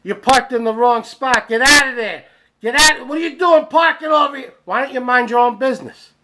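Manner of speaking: high, loud yell that builds as it goes; angry yelling. Retold or imitated. Man yelling at a girl parked in handicap spot, angry yelling. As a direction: yell like an outraged busybody. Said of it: "starts yelling at the girl".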